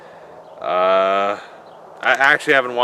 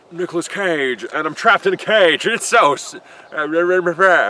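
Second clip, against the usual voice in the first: rough, half-yelled Nicolas Cage bit, caged and panicking; Nicolas Cage impression; 6 semitones higher, 3 dB louder than usual. Nicolas Cage trapped in a cage, a hammed-up panicky yell. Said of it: "do an impression of Nicolas Cage".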